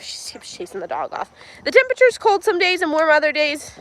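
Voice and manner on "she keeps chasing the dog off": whispered aside. Real, not acted. Aside while filming.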